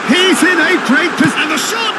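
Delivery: high, loud, urgent commentary; excited goal-chance call. Real, not acted. Commentator calling a great position and shot, excited. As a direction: voice leaps high as the chance opens up.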